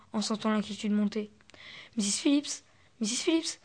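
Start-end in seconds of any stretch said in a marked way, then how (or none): 2.0-3.6 calling out higher and higher, rising to a cry; cried out, building. A narrator's reading. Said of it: "cria Miss Elva". (appel de plus en plus fort et aigu)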